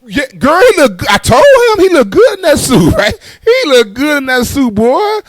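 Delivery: high, loud gushing church lady. Retold or imitated. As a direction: gush over the boy's suit.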